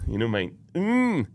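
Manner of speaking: ordinary talk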